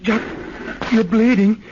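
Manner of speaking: sudden high, loud alarmed cry. Acted. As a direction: gasp it out in shock at the blood. Brother finds twin wounded.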